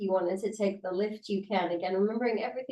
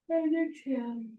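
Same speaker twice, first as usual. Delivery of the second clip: real yawn while cueing the breath; 6 semitones higher than usual. Real, not acted. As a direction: exhale cue swallowed by a yawn. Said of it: "sorry about my yawning".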